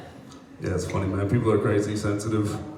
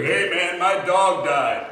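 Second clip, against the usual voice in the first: put-on pushy drunk dude; 6 semitones higher, slower than usual. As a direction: lean in too close and drag it out, drunk. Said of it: "he's like".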